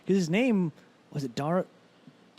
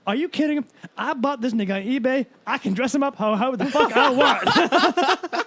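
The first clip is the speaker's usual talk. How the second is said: mock brash bravado; 9 semitones higher, 8 dB louder than usual. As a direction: sell the shocking punchline.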